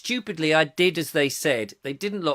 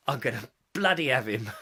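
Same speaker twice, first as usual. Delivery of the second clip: usual speaking voice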